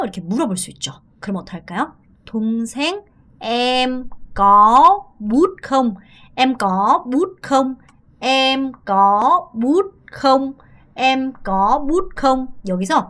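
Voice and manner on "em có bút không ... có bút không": slow demo drill. Shown as a demonstration. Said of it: "연음처를 많이 해줘요".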